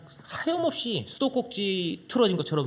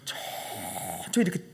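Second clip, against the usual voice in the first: hushed fearful; 9 semitones lower, 7 dB quieter, slower than usual. Retold or imitated. Slowly turning in fear.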